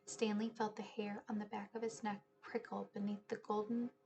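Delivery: whispered storytelling, barely voiced; hushed suspense. A narrator's reading. Narrator whispering the spooky museum scene to listeners. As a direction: breathe the words into the dark.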